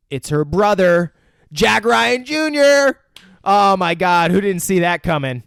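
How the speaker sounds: hammed-up twist announcement; mock shock reveal.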